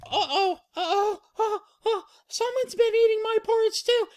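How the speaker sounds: squeaky falsetto building to a shout; Baby Bear upset. Acted. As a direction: start worried, end in a high wail. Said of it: "Baby Bear goes".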